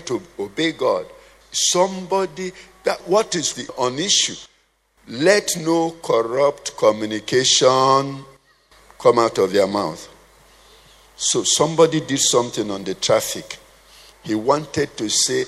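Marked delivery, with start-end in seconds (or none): 5.1-10.1 slow scripture quote. Real, not acted. Preacher quotes scripture slowly.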